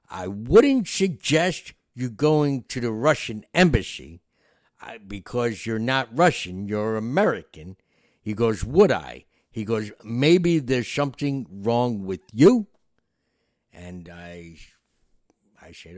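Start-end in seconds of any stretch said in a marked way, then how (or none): 0.1-7.8 annoyed conductor. Retold or imitated. Annoyed drunk conductor scolds. 8.7-9.2 loud indignant retort; loud, scornful comeback; annoyed conductor. Retold or imitated. 10.0-12.7 loud, slow, sneering jab; loud slow sneer at the passenger; annoyed conductor. Retold or imitated.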